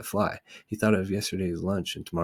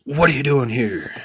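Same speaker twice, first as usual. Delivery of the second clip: growled; 7 semitones higher, 7 dB louder than usual. Acted. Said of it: "growled the watchdog".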